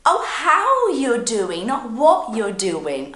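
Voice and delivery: "how" and "what" stretched slow, loud and high; contrastive stress. Acted. Learner realises the question was misheard, stressing "how" and "what". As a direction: lean hard on "how" against "what". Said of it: "no, no, how you doing".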